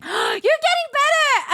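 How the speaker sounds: high, loud squeal of encouragement; excited praise. Real, not acted. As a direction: squeal it slowly with delight.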